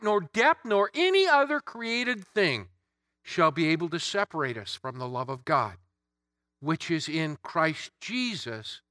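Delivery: ordinary talk, nothing marked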